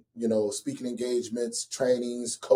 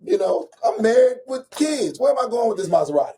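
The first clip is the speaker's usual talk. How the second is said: incredulous exclaim; 7 semitones higher, 5 dB louder than usual. Real, not acted. Exclaiming at himself.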